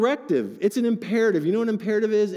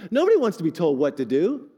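ordinary talk, nothing marked

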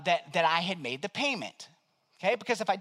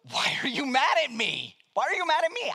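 high, laughing why are you mad; baffled chuckle at the angry agent; laughing disbelief; 5 semitones higher than usual. Retold or imitated. Pastor to the agent, laughing in disbelief.

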